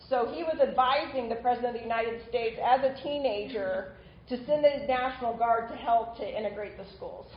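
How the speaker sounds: ordinary delivery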